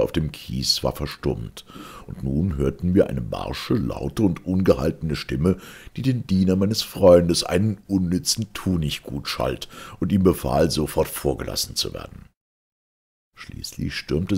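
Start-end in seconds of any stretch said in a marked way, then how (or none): none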